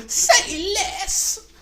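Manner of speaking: high, slow, laughing meme voice. Retold or imitated. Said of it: "my favorite meme is".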